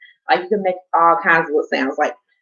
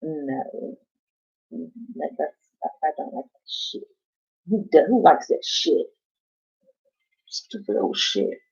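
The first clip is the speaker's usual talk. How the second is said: whispering her own grumpy answer, very soft; mock whispered reply; 4 semitones lower, 6 dB quieter, slower than usual. Mocking ASMR creators' style to viewers, whispered. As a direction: whisper the complaint as if in an ASMR video. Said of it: "And I just be like".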